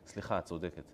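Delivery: low, soft climb-down; meek apology. Acted. Balaam backing down before his donkey, meek apology.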